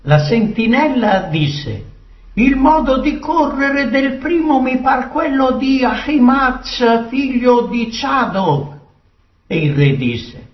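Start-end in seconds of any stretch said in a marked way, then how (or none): 2.3-8.7 higher-pitched sentinel reporting a sighting; watchful sentinel. Acted. Sentinel tells the king who is running, calling down. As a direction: report from the lookout in a raised pitch.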